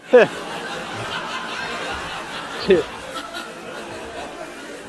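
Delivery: long scornful laugh at the haters; a drawn-out mocking laugh. Real, not acted. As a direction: laugh them off.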